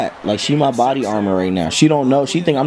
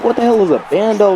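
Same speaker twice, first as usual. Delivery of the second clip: confused outburst; 9 semitones higher, 3 dB louder, faster than usual. Retold or imitated. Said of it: "I'm like".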